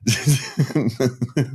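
high chuckle; short run of real laughter. Real, not acted. Laughs after own joking answer.

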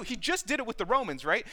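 ordinary talk

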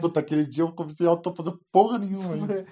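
loud teasing future self; loud mocking quote. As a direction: boom it out teasing him. Mocks friend's future self. (zombaria em voz alta)

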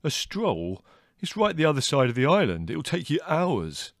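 incredulous brother. A narrator's reading. Leslie doubting the long walk, incredulous. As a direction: scoff at the distance. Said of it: "echoed Leslie".

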